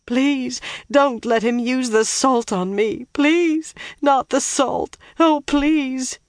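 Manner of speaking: high, panicked, tearful begging; wailing and babbling. A narrator's reading. Terrified captives begging not to face the salt, wailing. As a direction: wail it, pleading desperately for mercy. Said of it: "both of them wailing and babbling".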